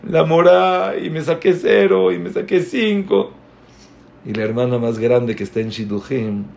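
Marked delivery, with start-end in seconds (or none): 0.1-3.3 imitated tearful whine, climbing higher; hammed-up sobbing confession; mock crying daughter. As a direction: wail it to mom like an upset schoolgirl. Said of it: "la hija llorando con la mamá".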